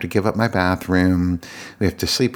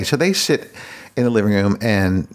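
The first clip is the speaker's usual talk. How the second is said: ordinary talk, nothing marked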